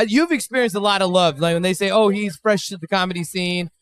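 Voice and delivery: normal talking voice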